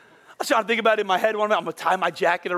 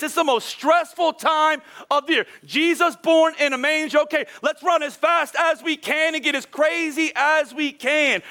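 worked-up mock frenzy; 8 semitones higher than usual. Real, not acted. Mocking frantic Christmas rush.

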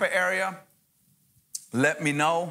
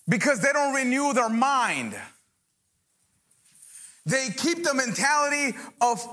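preaching; 8 semitones higher than usual. Acted. Pastor preaching to believers about renewing minds, fervent. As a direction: preach it with rising fire.

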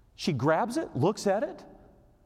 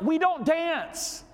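high, very drawn-out mock reproach; hammed-up, slow, high-pitched objection; retold scolding; 12 semitones higher, much slower than usual. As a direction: stretch each word in exasperated insistence. Retold scolding of dancing wife.

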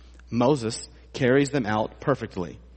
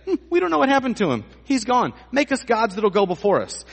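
high, fast, flippant mock voice; mocking the people; 9 semitones higher, 3 dB louder than usual. Preacher parodies the Israelites dismissing Moses, mocking. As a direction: brush Moses off with careless mockery. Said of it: "but they say".

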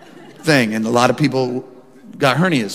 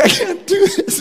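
high, teary cry of despair; tearful; slower than usual. Retold or imitated. Pastor imitating his wife crying outside the conference, tearful. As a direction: let her voice crack near tears. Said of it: "Rebecca just starts to cry".

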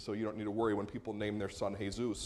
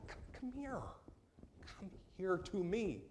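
emphatic tender call; 7 semitones higher, 8 dB quieter than usual. Acted. Jesus calling a weeping toddler, high and soft. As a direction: call a crying toddler to your arms.

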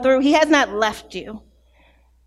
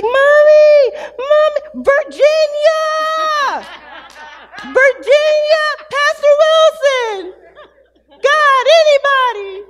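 high drawn-out mock wail for Mommy; child calling out; 14 semitones higher, 5 dB louder, slower than usual. Son crying out for his mother from his room, high loud wail. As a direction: imitate a little boy hollering loud and high for help. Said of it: "He would call me. He's like".

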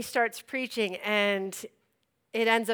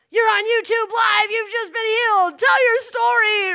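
high, loud hype; mock-thrilled announcement to camera; overexcited livestream host; 10 semitones higher, 5 dB louder than usual. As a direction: pounce with breathless enthusiasm. Imitates person thrusting livestream camera.

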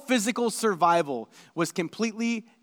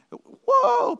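sharp high whoa; startled exclamation; surprised; 5 dB louder than usual. Real, not acted. Reacting to Paul's list.

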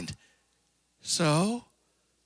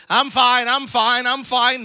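higher, louder insistent "I'm fine"; stubborn denial; 6 semitones higher, 6 dB louder, slower than usual. Retold or imitated. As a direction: brush it off stubbornly. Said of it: "You're like".

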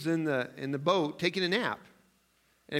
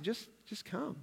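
higher, soft gentle call; tender invitation; 6 semitones higher, 8 dB quieter than usual. Retold or imitated. Jesus gently inviting the worried to come, soft. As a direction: coax softly, like calming a child. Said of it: "And he said".

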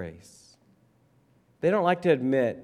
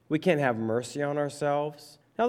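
ordinary talk

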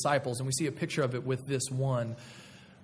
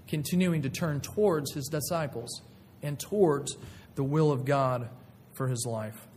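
ordinary talk

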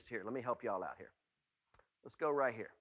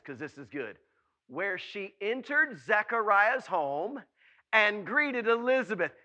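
normal talking voice

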